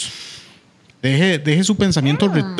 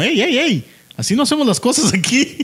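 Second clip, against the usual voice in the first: lightly raised Flash voice; a touch higher, scolding like Flash; mock hero telling him off; Flash voiced; 8 semitones higher than usual. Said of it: "Flash es como".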